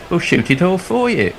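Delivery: high-pitched imitation of an eager volunteer; gushing enthusiasm. Creative director volunteers friend to groom-to-be, mock eagerness. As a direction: offer someone else up in a high mock-eager voice.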